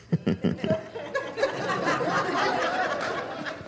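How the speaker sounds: high, breathless suppressed giggle; held-in laugh. Shown as a demonstration. Pastor demonstrating a rude customer's suppressed laugh. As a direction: show the strained laugh of someone holding it in. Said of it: "they start laughing a little bit".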